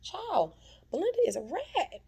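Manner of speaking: high, loud disgusted verdict. Real, not acted. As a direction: call her out with scorn. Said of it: "disgusted verdict".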